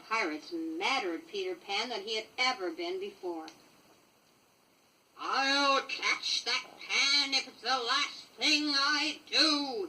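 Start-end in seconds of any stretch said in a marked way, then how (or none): 5.2-9.9 slow, menacing pirate captain voice; lightly voiced villain swearing revenge; Hook's vow. A narrator's reading. Captain Hook vows to catch Pan, slow and menacing. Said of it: "he vowed".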